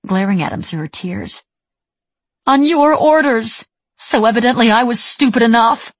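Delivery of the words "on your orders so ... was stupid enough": bitter angry retort. A narrator's reading. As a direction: spit each word out bitterly. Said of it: "she shot back".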